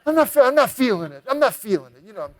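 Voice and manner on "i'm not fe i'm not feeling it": higher, quick, stammering back-out; a scared guest backing out fast; nervous refusal. Retold or imitated. Frightened guest refusing his brother at the burning building.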